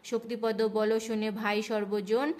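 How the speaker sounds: rhythmic chanted verse reading; panchali recitation. Acted. Reading Lakshmi's vrata tale aloud, rhythmic chant. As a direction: chant the rhymed tale to the household.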